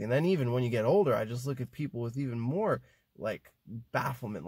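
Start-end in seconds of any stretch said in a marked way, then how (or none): none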